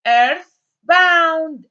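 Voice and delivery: earthbound called out high and loud; teacher projects earthbound to the room; loud model word. Shown as a demonstration. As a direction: announce earthbound loudly to the class.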